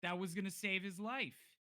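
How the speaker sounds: high, loud reproach; accusing. Acted. Kid blaming stranger for the lost scroll, accusing.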